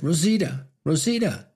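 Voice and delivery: high, loud calling out; shouting her name. A narrator's reading. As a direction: call out for Rosita in the dark.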